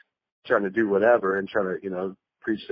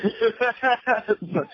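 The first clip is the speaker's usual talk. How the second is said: high laugh; 13 semitones higher than usual. Real, not acted. Laughs at forgetting.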